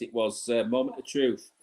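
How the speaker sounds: ordinary delivery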